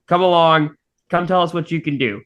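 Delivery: usual speaking voice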